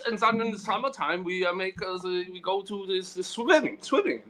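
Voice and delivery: put-on high, singsong Austrian accent. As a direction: ham up a Swedish accent, high pitched. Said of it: "Sounds Austrian".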